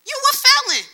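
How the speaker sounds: shrill taunting accusation. Retold or imitated. The world taunting the congregation's speaker, a mocking high cry. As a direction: jeer it high and loud, like the world pointing a finger.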